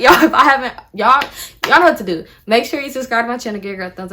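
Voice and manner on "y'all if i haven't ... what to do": loud, higher, laughing outro. Real, not acted. Laughing as she reminds viewers to subscribe. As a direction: laugh your way into the sign-off.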